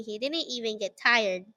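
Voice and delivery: ordinary delivery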